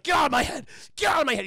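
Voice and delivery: loud, high desperate shout; acted frantic outburst; freaking out. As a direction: yell to drive the voices out. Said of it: "He's just freaking out".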